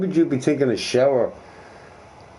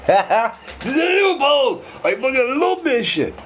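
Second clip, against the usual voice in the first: much higher, laughing and drawn out; gleeful laughing outburst; 14 semitones higher than usual. Real, not acted. Gamer gleeful about a loophole, high laughing delight. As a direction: crow with delight, half laughing.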